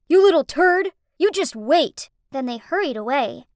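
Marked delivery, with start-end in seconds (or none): 0.1-2.1 a loud, high parting insult; shouting. Acted. Fleeing boys shouting a threat at Kurt, loud. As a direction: yell it back while running off. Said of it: "they turned around and shouted".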